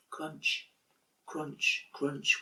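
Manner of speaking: quiet, hushed sound words; soft crunching steps. A narrator's reading. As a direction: tiptoe the crunches softly. Footsteps in the snow.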